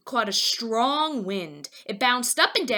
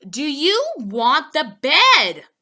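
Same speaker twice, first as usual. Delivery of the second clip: slow, louder lady voice; sales lady; 5 semitones higher, 9 dB louder, slower than usual. A narrator's reading. Sales lady pushing Poppleton to buy, slow and loud. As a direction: press him politely but firmly.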